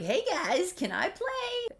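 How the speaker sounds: high, loud sing-out greeting; hammed-up clueless friendliness; oblivious cheer. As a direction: barge in cheerily, stretching the question up high. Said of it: "Blake's oblivious. He's just like".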